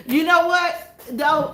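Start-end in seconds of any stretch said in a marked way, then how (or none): none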